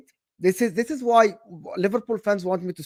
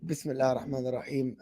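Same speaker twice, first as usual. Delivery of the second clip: lower, softer, very fast murmur; calm mumbled bismillah; 7 semitones lower, 9 dB quieter, much faster than usual. Shown as a demonstration. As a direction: rattle it off quietly under the breath. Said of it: "or you want me to be like".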